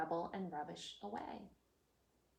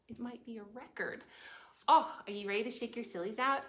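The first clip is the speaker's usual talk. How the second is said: usual speaking voice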